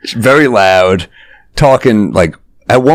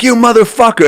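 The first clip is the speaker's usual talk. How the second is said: a much higher imitated yell from behind a door; mock yell; 12 semitones higher than usual. Drunk man locked in bathroom, mock yelling. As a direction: play the locked-in guy pounding and cursing. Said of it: "He's just banging like".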